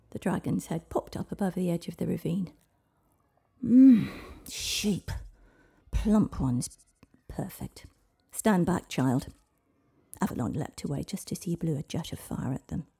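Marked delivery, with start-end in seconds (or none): 3.6-9.3 dragon voice. Acted.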